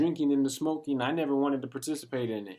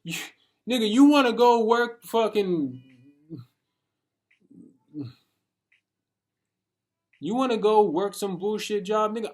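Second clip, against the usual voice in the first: loud, higher mocking yell; mocking a friend's job plan in a loud high yell; 9 semitones higher, 6 dB louder than usual.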